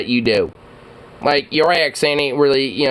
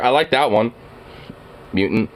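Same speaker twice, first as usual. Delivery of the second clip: normal talking voice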